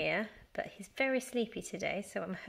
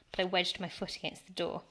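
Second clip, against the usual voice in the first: plain reading voice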